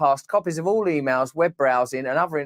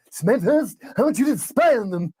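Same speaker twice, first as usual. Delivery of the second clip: boss voice; 5 semitones higher than usual. Acted. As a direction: order him about. Boss voice in skit.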